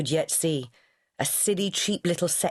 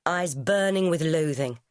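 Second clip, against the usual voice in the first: ordinary delivery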